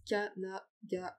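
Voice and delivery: syllable by syllable. Real, not acted. Presenter typing a tag on screen, syllable by syllable. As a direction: break it up as Ka-na-ga-wa while typing. Dictating to self while typing.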